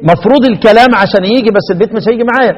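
ordinary delivery

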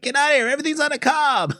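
high-pitched urgent mock warning; imitated alarm. Retelling a cartoon's cob-planet scene to a co-host, mock alarm. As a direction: raise the pitch sharply, as if sounding the alarm.

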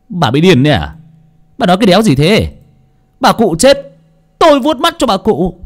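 loud, high disbelieving outburst; angry husband. Acted. Drunk husband shouts at his wife in angry disbelief. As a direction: snap at her like she's mad.

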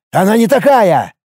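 high, indignant protest; angry, strained retort; furious. A narrator's reading. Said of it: "рассвирепел Пашка".

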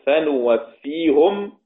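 slow فنوفيهم with nun, -hum; Rawh reading. Shown as a demonstration. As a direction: recite فنوفيهم ending -hum. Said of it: "روح".

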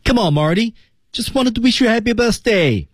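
high-pitched demo line; teacher's model reading. Shown as a demonstration. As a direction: read it as a clear, high-pitched model sentence. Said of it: "听老师读一遍".

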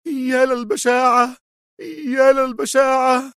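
high-pitched woman's cry of horror; horrified cry. A narrator's reading. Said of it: "وهي ترتجف هاتفة في ارتياع".

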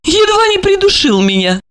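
a wry private thought; high female voice; wry lady. A narrator's reading. Olga's thought.

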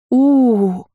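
high, loud, drawn-out wail; wailing sob. Acted. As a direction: burst into a howling cry. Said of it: "И тоже заревела".

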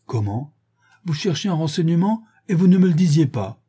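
lightly hammed surprise; Lupin surprised. A narrator's reading. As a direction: feign offended surprise.

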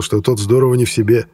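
usual speaking voice

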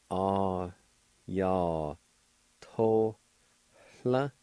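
Ah ya to la with a faint h before la; syllable by syllable. Shown as a demonstration. Teacher showing the faint H sound. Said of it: "It's pronounced like this".

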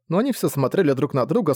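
ordinary delivery

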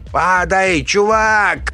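very high, urgent yell; high, desperate shout; shouted warning. A narrator's reading. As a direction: yell for him to drop. Yelling warning to fleeing man.

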